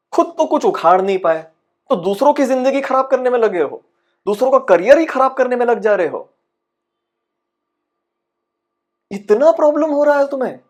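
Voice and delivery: high-pitched, exasperated rant; indignant accusation. Acted. Actor accuses others of ruining careers, high indignant rant.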